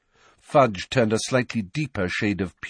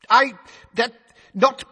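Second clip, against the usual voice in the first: high, loud sputtering minister; flustered official caught out; blustering; 7 dB louder than usual. A narrator's reading. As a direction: stumble over the words in a fluster. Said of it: "blustered Fudge".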